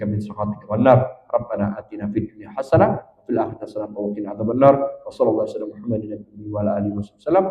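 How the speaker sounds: low, soft supplication; closing prayer. Real, not acted. Preacher asks Allah for safety from hellfire, low soft prayer. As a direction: recite the prayer quietly and evenly.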